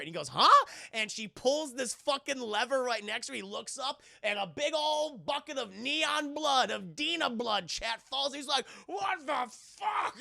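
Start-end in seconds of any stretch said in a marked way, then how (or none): 0.3-0.7 high, loud questioning "huh"; baffled mock yelp. Retold baffled reply to tag. 8.9-10.2 high, slow wail of disbelief; drawn-out shocked cry. Retold or imitated. Retold shock at falling blood.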